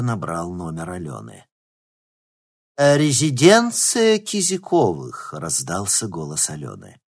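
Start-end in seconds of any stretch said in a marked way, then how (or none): 2.8-5.3 slow, higher lightly put-on maid voice; servant voice. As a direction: answer like a haughty housemaid. Alyona pretends to be a servant.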